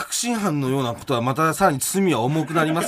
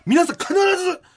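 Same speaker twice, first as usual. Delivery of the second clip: high-pitched prosecutorial declamation; mock courtroom denunciation; 11 semitones higher, 3 dB louder than usual. Mock lawyer denouncing the defendant to listeners, high and declamatory. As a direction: proclaim it like a hammy prosecutor.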